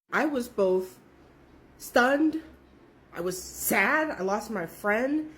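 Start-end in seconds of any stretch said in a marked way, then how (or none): none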